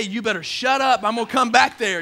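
loud, high scolding; mock parent threat. Parent snaps at kids in the back seat, loud mock threat.

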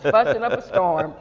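loud, through real laughter; laughing. Real, not acted. Host recalls fussing on the porch, laughing loudly. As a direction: laugh while telling it on yourself.

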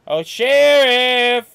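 long drawn-out call. Acted. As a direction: shout his name slowly. Calling out to sheriff.

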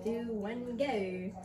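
usual speaking voice

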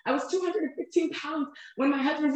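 ordinary talk, nothing marked

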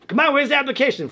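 high, quick impatient nagging; hounding teacher. Retold or imitated. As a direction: imitate a teacher badgering a kid. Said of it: "he was hounding me".

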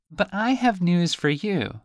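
high, squeaky little fish voice; high teasing fish; talking fish. Acted. Fish voice continues.

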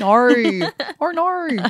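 stretched high Aussie "oh no"; exaggerated Australian vowels; broad Australian accent. Mirroring her Australian mum, hammed-up Aussie accent. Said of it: "My mum's really Australian".

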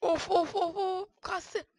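high, loud exasperated ofs; tense groaning. Real, not acted. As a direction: groan through the tense moment. Gergin anda inleme. (gergin inleme)

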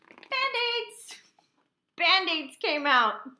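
high, loud exclamation, then drawn out; excited reveal. A narrator's reading. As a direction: blurt out the silly surprise. Surprise reveal in the story.

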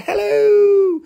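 high, loud squeaky hello; a tiny crystal piping up; crystal saying hello. Pretend. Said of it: "It said".

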